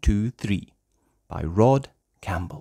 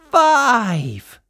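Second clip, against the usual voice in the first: high, louder surprised count; excited reveal; 11 semitones higher, 6 dB louder than usual. Acted. Reader revealing the sheep, excited. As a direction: gasp then announce it. Climactic count after gasp.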